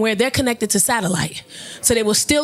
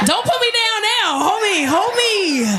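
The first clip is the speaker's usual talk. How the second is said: mock clinging plea; 7 semitones higher than usual. Kidnapped woman to her kidnapper, playfully pleading.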